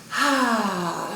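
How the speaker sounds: long open-mouthed exhale; audible sigh out. Shown as a demonstration. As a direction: let the breath fall out in a sigh. Teacher demonstrates releasing breath.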